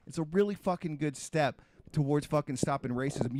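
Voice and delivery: usual speaking voice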